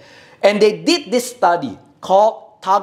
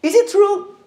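emphatic rhetorical question; 11 semitones higher than usual. Real, not acted. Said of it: "preacher's emphatic rhetorical question".

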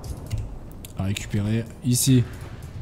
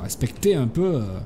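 ordinary talk, nothing marked

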